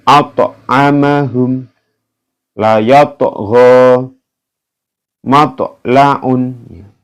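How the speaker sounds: slow recitation with bounced t; Quranic recitation. Shown as a demonstration. Teacher demonstrating qalqalah ta examples.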